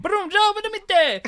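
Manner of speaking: high, bouncy mock Italian sing-song; Italian accent. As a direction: scat the tune like a hammy Italian. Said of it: "do Vincenzo".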